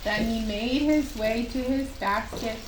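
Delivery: usual speaking voice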